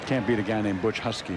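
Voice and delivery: ordinary talk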